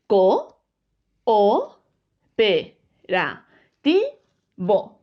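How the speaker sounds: "Co, o, pe, ra, ti, bo" one syllable at a time, pausing after each; split into syllables. Shown as a demonstration. Teacher splitting cooperativo for the pupils, syllable by syllable. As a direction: count out "Co", "o", "pe", "ra", "ti", "bo" separately. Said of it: "Separamos la palabra cooperativo en sílabas".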